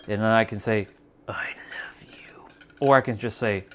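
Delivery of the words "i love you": breathy whispered I love you, slow; hushed secret I love you. Shown as a demonstration. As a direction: whisper it close and intimate. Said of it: "Same words, but different intention behind it".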